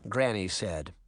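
ordinary delivery, nothing marked

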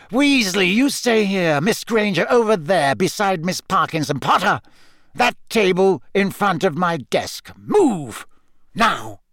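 high, forceful teacher's orders; curt commanding. A narrator's reading. Snape orders pupils to new seats, curt and commanding. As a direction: fire off each order, hard on the last.

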